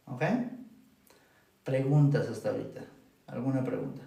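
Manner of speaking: ordinary delivery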